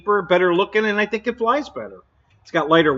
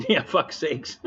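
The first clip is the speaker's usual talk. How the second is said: laughing; 3 semitones lower, 7 dB quieter, slower than usual. Real, not acted. Catches his own Avanti mix-up.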